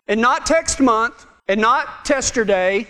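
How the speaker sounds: "text month" and "testerday" with a T swapped onto the front; joking T sounds. Shown as a demonstration. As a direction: deliberately start "text" and "testerday" with T for a gag. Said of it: "I'm going with the T deal".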